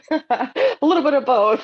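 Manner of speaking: high, loud laughing reply. Real, not acted. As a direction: laugh through the answer. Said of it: "you're saying preach?".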